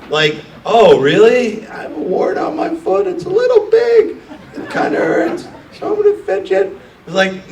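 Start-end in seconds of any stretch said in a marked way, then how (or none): 0.6-6.8 very high squeaky parody voice; mock founder whining about his wart; whiny mock pitcher. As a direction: squeal the excuses like a silly child. Mocking an excusing founder.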